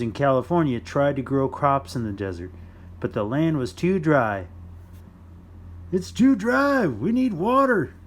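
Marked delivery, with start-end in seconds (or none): 5.9-7.9 high, loud pleading farmer voice; desperate farmers. Acted. The dry farmers beg for water, high and loud. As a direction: cry out for water like a thirsty farmer.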